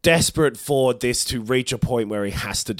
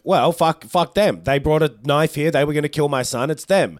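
loud, raised-pitch mock outrage; angry accusation; 5 semitones higher, 4 dB louder than usual. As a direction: cut in as the indignant queen. Acted queen's angry accusation.

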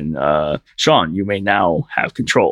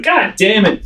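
frustrated villain; 13 semitones higher, slower than usual. Retold or imitated. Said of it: "he's like".

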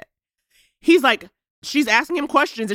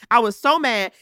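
ordinary talk, nothing marked

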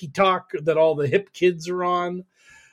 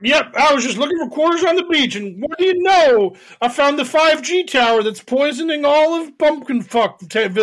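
high goofy yokel voice; dopey aw shucks innocence; fake bashful aw shucks; 8 semitones higher than usual. Mocking the conspiracy guy.

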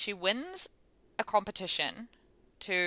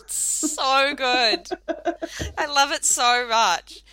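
delighted gush; 7 semitones higher, 7 dB louder, much slower than usual. Real, not acted. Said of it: "gushing over the tweet".

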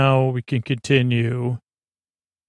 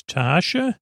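higher, rising, questioning name; surprised question; 5 semitones higher than usual. Retold or imitated. O'Brien sees Tasha on screen, surprised question. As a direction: say it as a puzzled double-take. Recognizing Tasha in a WTF moment.

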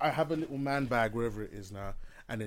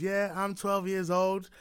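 slow, higher mock child's boast; brazen twelve-year-old; 6 semitones higher, slower than usual. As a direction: drag it out like a defiant kid on TV. Imitates brazen child on talk show.

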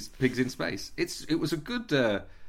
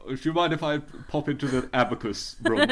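normal talking voice

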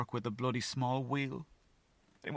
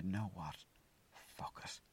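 whispered fuck it; 3 semitones lower, 11 dB quieter, slower than usual. Retold or imitated. Quiet giving up.

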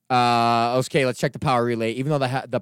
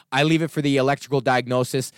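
ordinary talk, nothing marked

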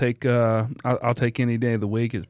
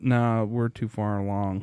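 ordinary talk

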